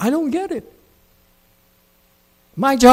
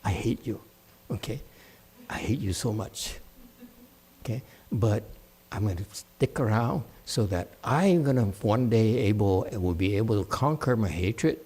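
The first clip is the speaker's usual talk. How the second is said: much softer, lower mock resentment; mock quiet grudge; 6 semitones lower, 8 dB quieter than usual. Monk imitates a student who hates him but stays, quietly. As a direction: mumble the grudge under your breath, mocking. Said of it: "Then I come and feel".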